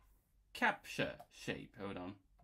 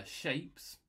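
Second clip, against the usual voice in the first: normal talking voice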